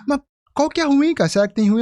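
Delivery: mock worried uncle; curious uncle.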